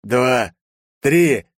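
counting up to a shout; threatening count. Acted. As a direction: build the count into a yell. Counting before stomping captive.

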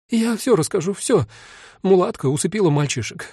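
high, breathless desperate pleading; panicked confession. A narrator's reading. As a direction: confess everything, begging to be saved. Owner keeps confessing.

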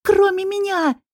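high-pitched, indignant outburst; sharp interruption. A narrator's reading. Woman interrupts the intruder's denial, indignant. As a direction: cut in with pitch leaping up. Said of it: "перебила его".

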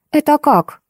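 high, shrieking question; shrill squeal. Acted. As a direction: squeal it in alarm. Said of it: "взвизгнула Ольга".

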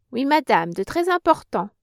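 firm and resolute, pitch rising on très important; a decided young woman's reply. A narrator's reading. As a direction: answer with decision. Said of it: "répondit Germaine d'un ton décidé".

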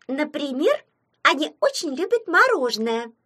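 put-on high, lilting girl's voice; smiling Snow Maiden. A narrator's reading. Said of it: "улыбнулась Снегурочка".

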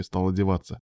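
usual speaking voice

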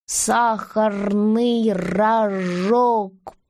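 very slow, sounding out each syllable; a child reading a label aloud. Acted. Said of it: "и продолжил чтение".

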